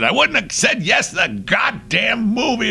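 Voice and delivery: high mock-furious shout; hammed-up outburst of an angry performer. Singer refusing the director's plan, acted fury. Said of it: "he was furious".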